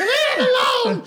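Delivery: high shrill mock shriek of a frantic girlfriend; retelling the girlfriend screaming at the fighters, high mock shriek; shrieking girl. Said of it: "Now the girl's out there".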